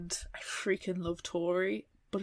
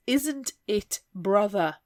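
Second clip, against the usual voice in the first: crisp, proper posh correction; proper enunciation; 6 dB louder, slower than usual. Shown as a demonstration. Snob correcting slang with proper speech. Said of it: "Speak properly".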